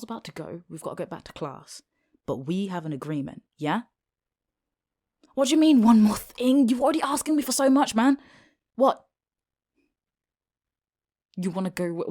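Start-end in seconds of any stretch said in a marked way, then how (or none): 5.3-9.0 higher, much louder, fast protest; indignant outburst. Acted. Girl protesting loudly at one more demand. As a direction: throw it back in disbelief.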